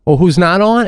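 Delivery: normal talking voice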